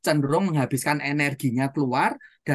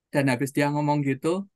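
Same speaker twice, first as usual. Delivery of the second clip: ordinary delivery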